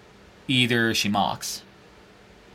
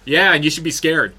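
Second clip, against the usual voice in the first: louder, higher taunting challenge; mock tough-guy threat; 4 semitones higher, 7 dB louder than usual. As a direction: square up and taunt him. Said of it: "be this far from somebody and go".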